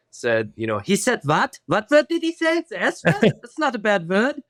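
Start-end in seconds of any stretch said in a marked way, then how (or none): 0.8-3.2 high-pitched, indignant German mom; mom's German accent. Retold or imitated. Mom questioning the school about her son's word, thick German accent. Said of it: "in her, her thick German accent". 3.2-4.4 put-on high, slow German-accented protest; mom's German accent. Mom defending the word to the principal, thick German accent. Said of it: "in her, her thick German accent".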